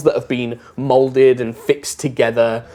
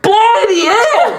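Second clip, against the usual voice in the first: very high, loud character shout; astonished shopkeeper; 7 dB louder, slower than usual. Acted. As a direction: cry out in comic amazement. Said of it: "What's his reaction first?".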